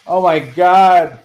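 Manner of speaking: loud, slow "oh my God"; surprised exclamation. Real, not acted. Streamer reacting to a viewer's message, loud and slow amazement.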